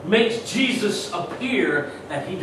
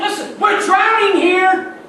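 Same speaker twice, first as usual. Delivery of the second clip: high, loud pleading cry; frightened disciples shouting at a sleeping Jesus; desperate shout; 13 semitones higher, 9 dB louder, slower than usual. Acted. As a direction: yell it like you're going under. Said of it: "and you're saying".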